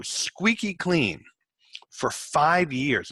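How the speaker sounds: drawn-out squeaky clean and five years, slow and weighty; slow, deliberate warning to a client; slow emphatic warning. Shown as a demonstration. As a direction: stretch every word out to drive the point home. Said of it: "you have to understand something".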